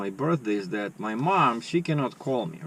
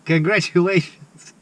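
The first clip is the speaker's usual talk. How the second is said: louder, higher mock nurse congratulating; pretend nurse; 6 semitones higher, 8 dB louder than usual. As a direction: beam it out as the ward nurse. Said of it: "kinda like as if I'm a nurse".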